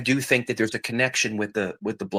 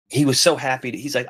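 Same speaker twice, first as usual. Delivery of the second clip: normal talking voice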